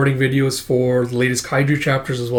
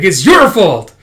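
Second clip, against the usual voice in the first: loud, higher accusation; mock accusing; 5 semitones higher, 8 dB louder, slower than usual. Character blaming a fellow pupil, mock accusation. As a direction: throw the blame with a raised voice.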